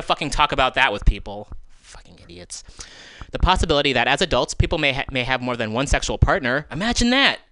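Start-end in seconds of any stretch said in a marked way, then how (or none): 1.8-2.6 under-the-breath curse, much softer; muttered insult. Real, not acted. Cursing the school board to herself, muttered. 6.7-7.4 mock-amazed, jumping much higher; sarcastic. Real, not acted. Scoffing at the parents' objections, sarcastic.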